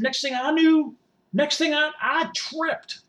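high, strained build to the mishap; worked-up confession. Acted. Supporter running toward the Capitol, worked-up confession. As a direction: pitch it high as the disaster arrives. Recounts his fall, worked up.

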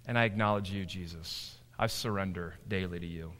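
low, hushed prayer of surrender; quiet surrender. Acted. Believer yielding to Jesus in prayer, low and quiet. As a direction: drop low and soft, yielding.

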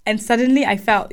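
ordinary delivery

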